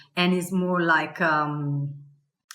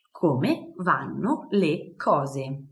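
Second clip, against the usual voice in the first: very slow model phrase; 3 semitones lower, much slower than usual. Shown as a demonstration. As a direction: Come vanno le cose drawn out slowly.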